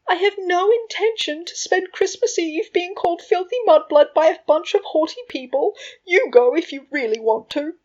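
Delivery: bossy, high, loud imitation; Lily. A narrator's reading. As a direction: mimic a bossy wife laying down the law. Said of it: "he said in a bossy voice meant to imitate Lily's".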